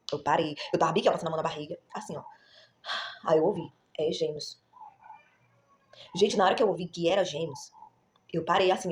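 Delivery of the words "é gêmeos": a gentle, lower voice of God speaking; soft sweet voice. Retold or imitated. A mother retelling the divine voice, lightly voiced softly. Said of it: "Uma voz doce".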